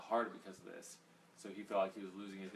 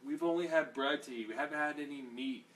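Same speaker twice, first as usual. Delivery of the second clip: whining; 5 semitones higher, 5 dB louder than usual. Retold or imitated. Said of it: "they just whine like that".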